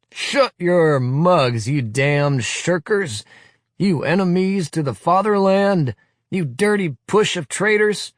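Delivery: high, loud, slow furious tirade; thundering. Acted. Leader thundering at the veterans, furious tirade. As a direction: thunder abuse at the shirkers. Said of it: "glares and thunders".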